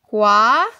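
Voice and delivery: kwa said alone, loud and held; syllable by syllable. Shown as a demonstration. Teacher sounding out a Korean syllable for the class, slow and clear.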